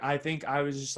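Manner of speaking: usual speaking voice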